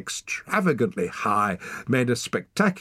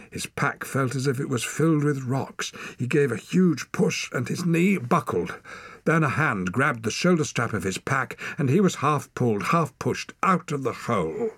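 ordinary delivery

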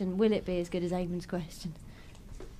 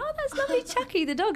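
gushing over dog; 14 semitones higher than usual. Retold or imitated. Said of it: "I was like".

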